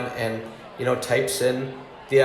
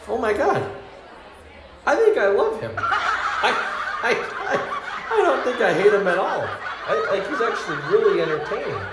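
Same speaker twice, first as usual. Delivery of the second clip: much higher, laughing, giddy discovery; giddy realization; 8 semitones higher than usual. Retold or imitated. Teller relives realizing she loves her coworker, giddy laughter. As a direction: squeal it like a sudden crush.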